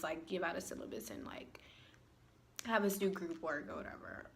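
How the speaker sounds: unvoiced, low aside; hushed side comment; whispered aside. Real, not acted. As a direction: whisper it like a secret. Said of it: "whispered aside".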